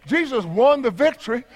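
ordinary delivery

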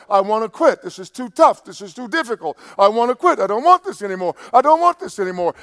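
loud, high, insistent protest; acted desperate complaint. Preacher reliving his plea to the Lord in Cairo, loudly desperate. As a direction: pile up each complaint with mounting force. Acts out own plea to God.

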